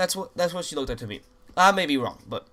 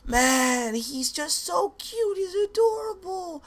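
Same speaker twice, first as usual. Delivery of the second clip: much higher, slow, swooning teen girl voice, mocking her; mock fangirl gush; slower than usual. Mocking a teen girl gushing over [name]'s photos, high and swooning. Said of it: "she was looking at pictures and saying".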